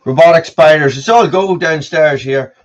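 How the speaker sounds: loud call across the house; yelling across the room. Real, not acted. Calling out to daughter.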